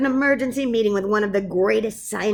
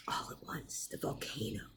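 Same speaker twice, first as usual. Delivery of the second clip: plain reading voice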